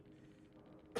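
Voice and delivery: harsh real coughs; coughing fit. Real, not acted. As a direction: cough hard. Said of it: "I really got some bad coughs there".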